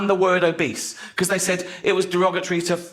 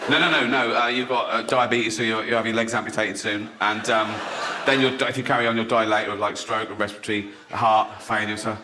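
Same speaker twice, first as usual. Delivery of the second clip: low-pitched doctor delivering bad news; matter-of-fact doctor; 7 semitones lower than usual. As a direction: drop low and list the prognosis. Acted doctor giving grim diagnosis.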